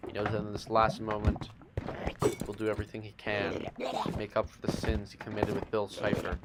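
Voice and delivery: normal talking voice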